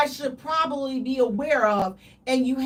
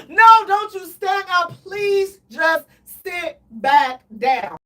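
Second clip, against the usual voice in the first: louder, then slow plea; mock pleading shout; 4 semitones higher, 7 dB louder, slower than usual. To the partner standing up, a mock pleading yell. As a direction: yell then beg them to sit.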